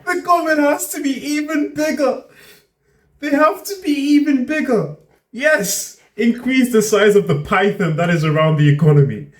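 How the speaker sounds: very high, sarcastic cheerleading; mocking enthusiasm. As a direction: squeal it in mock approval. Mocks the clip's demand for bigger government.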